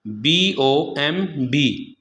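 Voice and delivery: बी ओ एम बी spelled out slowly, one letter at a time; slow spelling of बी ओ एम बी for the class; letters of बी ओ एम बी read out slowly and separately. Shown as a demonstration. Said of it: "जैसे bomb".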